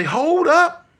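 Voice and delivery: high urgent hold up; pleading alarm; high panicked wait a minute. Retold or imitated. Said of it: "I say".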